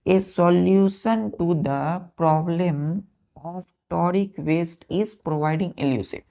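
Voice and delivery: whole sentence read very slowly; teacher rereading the sentence for pupils; slow dictation. Shown as a demonstration. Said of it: "जैसे हमने बोला".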